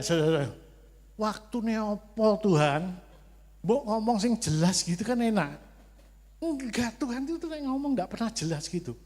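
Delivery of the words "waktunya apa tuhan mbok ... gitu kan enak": exasperated complaint. Retold or imitated. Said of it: "retorts to God".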